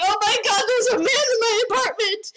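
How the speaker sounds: high, fast, panicked crying screech; panicked high shriek. Retold or imitated. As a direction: shriek it like she's terrified of an intruder. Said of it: "she's like".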